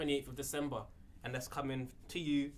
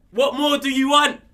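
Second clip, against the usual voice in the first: loud showman; 12 semitones higher, 17 dB louder than usual. Acted. Showing off the prize ticket.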